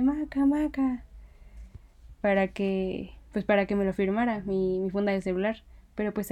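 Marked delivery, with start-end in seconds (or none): 0.0-1.0 high, drawn-out mock yell; high-pitched pretend shouting, held back in volume; imitated calling out. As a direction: imitate someone calling out to a star, stretched and high. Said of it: "empezó a gritarle a".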